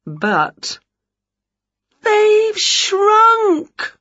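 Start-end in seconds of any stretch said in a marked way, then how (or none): 2.0-3.9 high wailing cry over shrunken clothes. Acted. Winnie wails at her shrunken clothes, high and wailing. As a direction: wail it like a small disaster. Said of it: "wailed Winnie".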